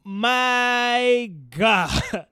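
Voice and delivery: loud, high, amazed outburst; excited exclamation. Real, not acted. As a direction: blurt it out, overwhelmed. Overwhelmed praising Attack on Titan.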